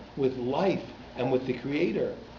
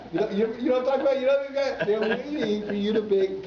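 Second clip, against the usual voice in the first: excited; 8 semitones higher than usual. Real, not acted. Mocking the eager correction guy.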